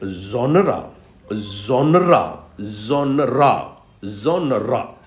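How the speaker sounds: genre said as two syllables, zon-ra; teacher repeating genre as two syllables. Shown as a demonstration. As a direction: sound out genre with two beats, not one. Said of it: "which is wrong. There are two syllables".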